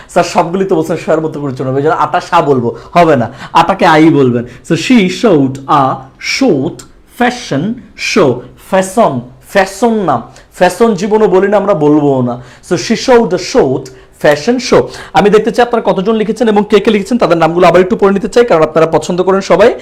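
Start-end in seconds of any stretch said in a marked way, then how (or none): 4.7-8.3 "she showed a short fashion show" very slowly, every sh clear; word by word "she showed a short fashion show" with full sh; sh sound. Shown as a demonstration. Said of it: "শ এর মতো করে উচ্চারণ হলে". 12.8-14.8 slow "she showed a short fashion show", clear sh; sh sound. Shown as a demonstration.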